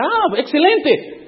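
slow, high, pleased drawl; delighted director. Retold or imitated. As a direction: savour the word like good news.

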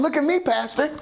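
put-on proud young churchgoer. Proud young churchgoers showing off their ties.

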